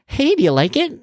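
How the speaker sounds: high-pitched hopeful question; eager cookie vendor. Retold or imitated. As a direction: imitate a vendor fishing for approval. Acting cookie vendor asking.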